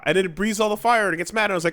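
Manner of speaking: ordinary talk, nothing marked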